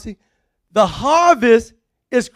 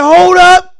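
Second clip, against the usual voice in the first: raised, louder objection; startled protest; 6 semitones higher, 6 dB louder than usual. Acted. Hezekiah halting the prophet, in startled protest. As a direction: push it up high and loud to stop someone.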